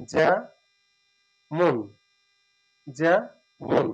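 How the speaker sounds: যেমন stretched very slowly; slow demo pronunciation. Shown as a demonstration. Teacher modelling যেমন slowly for students. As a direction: hold each sound of যেমন. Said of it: "এভাবে তো উচ্চারণ করি".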